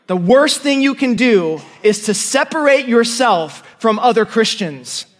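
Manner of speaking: ordinary delivery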